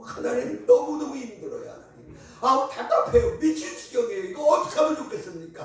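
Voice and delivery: loud, high desperate prayer; crying out to God. Shown as a demonstration. Preacher shows the church how to cry out in prayer, loud. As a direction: pour out the complaint loudly. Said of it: "들어와갖고 소리 지르세요".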